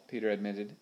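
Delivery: plain reading voice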